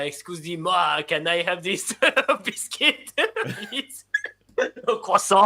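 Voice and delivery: hammed-up American attempt at a French accent; mock French accent with giggles breaking through; American-style French accent. Shown as a demonstration. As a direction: put on a bad French accent like an American, laughing. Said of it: "speak like how an American would do a French".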